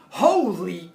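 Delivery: a sudden, shocked "Holy!"; startled loud exclamation. Retold or imitated. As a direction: blurt it out loud, caught off guard. Cops shocked at the beer.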